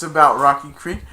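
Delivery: ordinary talk, nothing marked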